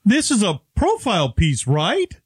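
high, cartoonish snowman voice; mock him as a childlike cartoon character; Frosty the Snowman. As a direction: pitch it up like a kids' cartoon snowman. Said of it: "he talks like Frosty the Snowman".

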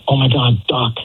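retold panicky yell. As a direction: imitate her urgent outburst in a high voice. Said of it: "she literally yells out".

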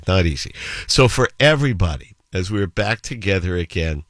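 ordinary talk, nothing marked

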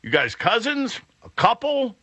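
high, rising mock astonishment; mock surprise. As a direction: toss out the guesses in playful disbelief. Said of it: "I'm like".

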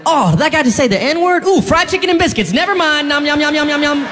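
high, giddy voice melting over food; mock distracted diner. Comedian acting a South Carolinian won over by biscuits, high and giddy. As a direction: swoon over the fried chicken. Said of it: "it's kinda like".

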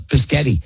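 "Pischetti" starting puh-sketty; a small child's word, "Pischetti"; child's mispronunciation. Retold or imitated. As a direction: jumble spaghetti into "Pischetti". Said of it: "I did mispronounce Pischetti".

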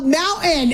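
disgusted high "mountain" with a dropped t; mock "mountain" as "moun-EN"; mimicked mispronunciation. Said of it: "That makes my skin crawl".

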